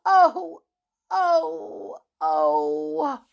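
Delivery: high pleading oh, oh, oh; distressed oh's. Acted. Flip begging his sick friend, pleading. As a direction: plead like a frightened little rabbit.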